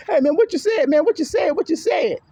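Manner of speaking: desperate pleading. Retold or imitated. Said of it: "click back on the grandson right thought and say".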